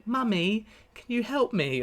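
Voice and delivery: put-on high little kid asking for help; little child's voice. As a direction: play a small child calling out. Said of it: "she hears a voice go".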